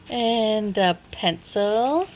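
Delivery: ordinary talk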